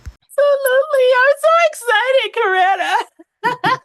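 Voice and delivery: can barely contain how thrilled she is; bursting with excitement. Real, not acted. Greeting the host Coretta, bursting with real excitement. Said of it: "I'm trying to calm down".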